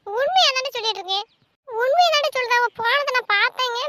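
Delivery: high, loud protest; agitated insisting. Acted. Sister disbelieved by brother, agitated loud insistence. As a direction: insist loudly against being doubted.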